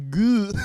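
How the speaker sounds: high, loud "gah" of dismay; pained, exaggerated yelp of disgust; mock cringing groan. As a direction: imitate an audience member recoiling at bad acting. Imitating audience member's reaction to bad acting.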